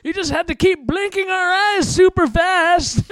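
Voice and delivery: put-on high old-timer voice; old prospector. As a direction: reminisce like an old geezer.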